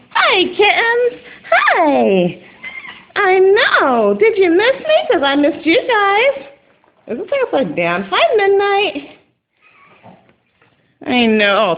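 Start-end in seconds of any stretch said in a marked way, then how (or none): 0.1-6.5 high cooing pet voice. Real, not acted. Owner greeting her kittens, high cooing baby talk. 8.1-9.2 cooing. Real, not acted. Greeting cat.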